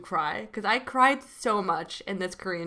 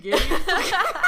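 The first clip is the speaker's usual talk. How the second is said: building to a high, loud laughing squeal; real bubbling excitement, laughing; excited; 11 semitones higher, 8 dB louder, faster than usual. Real, not acted. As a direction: start near normal, then burst with giddy excitement. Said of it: "I'm getting so excited".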